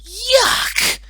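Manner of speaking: high, loud cry of disgust; a child's loud yuck of disgust. A narrator's reading. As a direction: shriek it out in revulsion. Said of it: "cried Deborah Jo".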